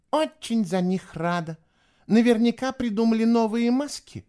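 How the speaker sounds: high-voiced, dismissive girl; scoffing. A narrator's reading. Eva not believing Fisher's story, scoffing. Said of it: "хмыкнула Эва".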